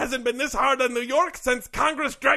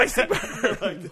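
slow, laughing "icy burn"; laughing catchphrase; 3 semitones lower, much slower than usual. Acted. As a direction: drag out the catchphrase through a laugh.

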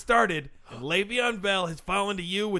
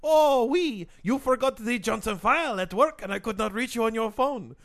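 put-on French accent. French intern voiced, accent.

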